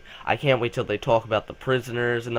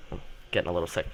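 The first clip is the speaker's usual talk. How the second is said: normal talking voice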